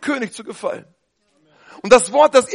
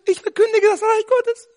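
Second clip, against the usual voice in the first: squeaky little mouse; 11 semitones higher than usual. Pretend. Preacher mocking timid proclaimers, squeaky and high. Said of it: "Piepsmäuschen".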